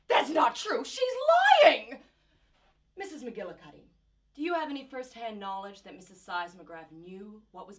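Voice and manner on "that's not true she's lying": crying denial. Acted. Mother denying the testimony, near tears. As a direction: gasp then a tearful loud protest.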